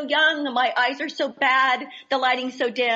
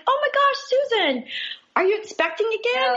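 gushing excitement; 10 semitones higher, faster than usual. Retold or imitated. Retelling her blunder to a church friend, gushing. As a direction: gush it out like you just spotted big news. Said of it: "I'm like".

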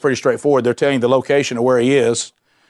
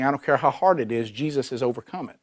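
quiet reassurance; 2 semitones lower, 8 dB quieter than usual. Real, not acted. Preacher comforting congregation quietly.

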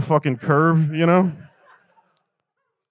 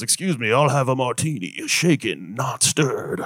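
a hint of a suave spy ordering; understated imitation of James Bond; mock Bond. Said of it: "he goes".

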